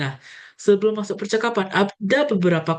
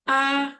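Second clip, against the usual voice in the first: A high and level; erster Ton; 9 semitones higher than usual. Shown as a demonstration. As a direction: sustain A evenly, up high.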